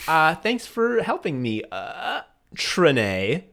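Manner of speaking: lightly imitated overfriendly customer; mock charm, groping for a name; trying to be friendly. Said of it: "trying to be friendly and charming".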